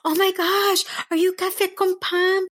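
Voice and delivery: high-pitched starstruck gushing; excited fan. Retold or imitated. Voicing a girl and mom meeting a podcaster, excited. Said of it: "they were so excited".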